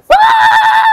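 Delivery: extremely high, loud scream; piercing shriek. Real, not acted. Player nearly making it in game, piercing shriek. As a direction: shriek at the top of the range.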